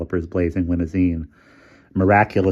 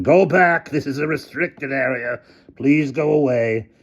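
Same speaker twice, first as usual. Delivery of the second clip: higher, louder, slow shout; desperate megaphone shout; 7 semitones higher, 4 dB louder than usual. A narrator's reading. Hamilton desperately shouting at the crowd over the megaphone. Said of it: "shouted Hamilton desperately over the megaphone".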